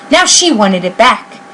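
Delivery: ordinary delivery, nothing marked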